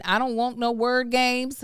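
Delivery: normal talking voice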